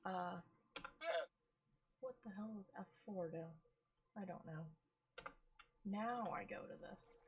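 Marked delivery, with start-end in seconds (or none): none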